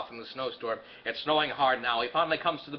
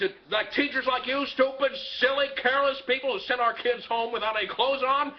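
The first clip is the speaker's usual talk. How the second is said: hammed-up furious telling-off, pitched higher; angry rant; 8 semitones higher than usual. George Bailey rants at his child's teacher on the phone, angry.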